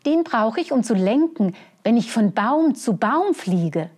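high, eager little squirrel. Acted. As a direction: chirp it out like a proud squirrel. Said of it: "erklärte es".

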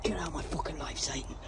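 whispered, voiceless banishing of Satan; hushed curse. Real, not acted. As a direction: whisper it low after a choking fit. Banishing Satan after choking.

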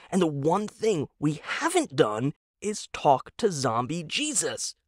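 voiced husband, keen and hopeful; eager husband Tom. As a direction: pitch it up like a naive true believer. Tom's eager pitch.

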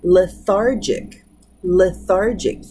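lethargic said slowly with the stress on THAR; slow stress demo. Shown as a demonstration. Teacher slowly modelling lethargic with the stress on THAR. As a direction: draw out lethargic, leaning on THAR. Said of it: "You can hear it on here, the second to the last".

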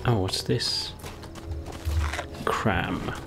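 ordinary talk, nothing marked

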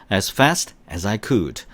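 very slow, every word drawn out; teacher's slow repeat-after-me pace; slow model reading. Shown as a demonstration. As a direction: read it slowly for students to repeat. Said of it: "시작".

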